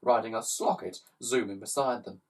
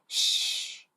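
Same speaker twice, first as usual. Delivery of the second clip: whispered shh. Acted. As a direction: hush him quickly. Shushing Slime.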